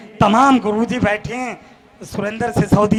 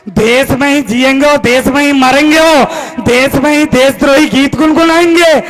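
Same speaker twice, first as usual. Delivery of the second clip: rhythmic declamation building to a raised cry; fiery stage-poet delivery; poem recitation; 3 semitones higher, 3 dB louder than usual. Acted. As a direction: proclaim the verse to the crowd, stretching key words. Recites verse at poetry gathering.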